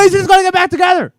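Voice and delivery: high, fast, loud hammed-up fangirl shout; mock fan shout. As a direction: scream it like a thrilled fan faking joy. Mock fan cheering reunion news.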